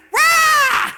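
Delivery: sudden high, very loud roar; angry roar. Retold or imitated. Loud wife blowing up at husband, angry roar. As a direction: explode in one wordless yell. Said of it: "she's like".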